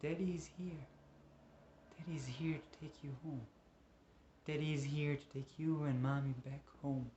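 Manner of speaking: put-on hushed, soothing baby talk; tender father. Father comes to take daughter home, tender hush. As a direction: murmur it softly to a little child.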